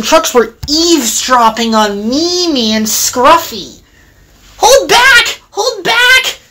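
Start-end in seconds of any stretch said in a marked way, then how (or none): 4.6-6.4 very high mischievous shouts; gleeful shouting. A narrator's reading. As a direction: yell it like trucks making trouble. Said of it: "they laughed".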